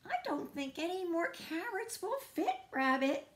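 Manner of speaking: high-pitched little squirrel voice; worried Squirrel. Acted. Squirrel warns Rabbit the house is full, high and doubtful.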